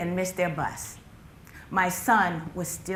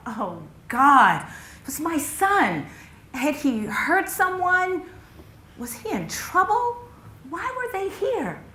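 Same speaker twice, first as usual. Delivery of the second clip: high, anguished questioning; mother's rising panic; panicked thought; 8 semitones higher, 5 dB louder, slower than usual. Acted. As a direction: climb higher with each fearful question. Panicked first thought at police car.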